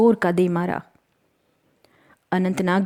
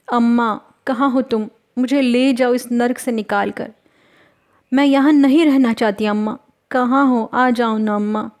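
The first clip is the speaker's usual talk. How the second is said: higher, plaintive cry for mother; pained pleading; 3 semitones higher, 4 dB louder than usual. A narrator's reading. Hurt girl calls for her mother, pained pleading. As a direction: call out to an absent mother in pain. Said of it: "दर्द के मारे अपनी अम्मा को याद किए जा रही थी".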